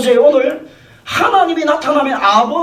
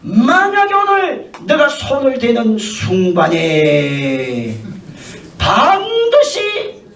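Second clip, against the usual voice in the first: slow, booming solemn decree; drawn-out divine warning; voice of God; much slower than usual. Acted. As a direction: proclaim it slowly like God from heaven. God speaks to Abimelech in dream.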